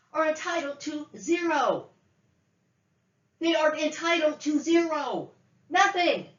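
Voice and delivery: high, emphatic angry declaration; angry insistence. Real, not acted. Woman denying her family any money, angry and emphatic. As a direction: drive it home in indignant high pitch.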